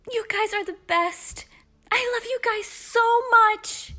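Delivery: squealed, grateful outpouring; gushing affection. Acted. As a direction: gush to your friends, stretching it out. Girl gushes thanks to friends.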